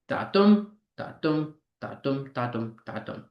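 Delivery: five "da-dum" pairs, weight on "dum", dying away; iambic beat. Shown as a demonstration. Teacher sounding out iambic pentameter, rhythmic and stressed.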